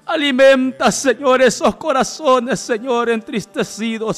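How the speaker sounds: loud, high plea that gradually settles; crying out in prayer. Real, not acted. Pleading to God for saddened hearts, impassioned cry. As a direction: call out urgently to God.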